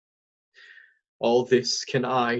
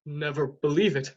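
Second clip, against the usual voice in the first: normal talking voice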